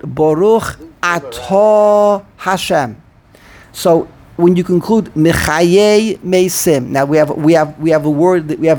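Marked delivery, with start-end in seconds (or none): 0.0-3.0 slow and measured, "Atah" drawn out as a-TAAH; stress on word's end. Shown as a demonstration. Rabbi showing Milah Ra, slow with end-stressed "Atah". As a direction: lean long on the end of "Atah". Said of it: "The end of the word".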